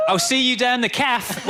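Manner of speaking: put-on high, loud Essex accent; laughing Essex twang. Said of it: "compliment you on your Essex accent".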